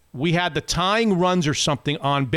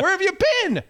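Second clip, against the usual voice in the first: high pitched call; 13 semitones higher, faster than usual. Retold or imitated. Calling the stranger in from the fence, high shout. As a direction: shout it like he's late. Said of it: "I said".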